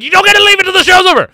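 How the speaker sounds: fast, high, loud yell; shouted order. Retold or imitated. Captor yelling at a contestant who wants out, fast loud shout. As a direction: bark the rule at her. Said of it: "You're like".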